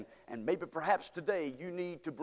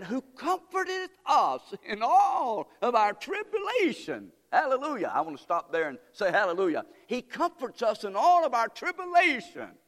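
preaching at full force; 6 semitones higher, 3 dB louder than usual. Acted. Preacher exulting over comfort, high and loud. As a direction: cry out the verse.

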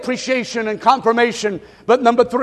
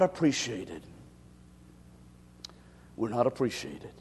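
much softer, lower, almost to himself; hushed sorrowful drop; 12 semitones lower, 15 dB quieter than usual. Acted. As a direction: let it fall into a hush after the shouting. Drops after the climax.

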